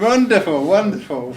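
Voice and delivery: high, loud burst of delight; delighted exclaim. Real, not acted. Presenter thrilled to hear Lee is Welsh, excited. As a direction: cheer the news. More Welsh people online.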